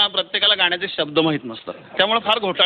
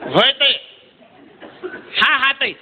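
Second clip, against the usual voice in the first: high, loud, calling ताई down the line; loud Solapuri boy on the phone; 5 semitones higher than usual. Retold or imitated. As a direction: shout into the phone like a village lad. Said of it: "कसं बोलत होता बघा तुम्ही".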